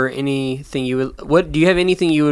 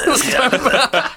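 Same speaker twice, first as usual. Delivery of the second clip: laughing; 10 semitones higher, slower than usual. Real, not acted. Laughing while repeating.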